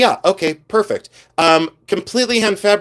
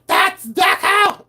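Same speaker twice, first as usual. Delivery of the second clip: much higher, slow drawn-out shout; slow mock shout; much slower than usual. Mocking collectors who insist it's Deco, a slow high shout. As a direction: stretch it out, yelling way up high. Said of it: "They start screaming".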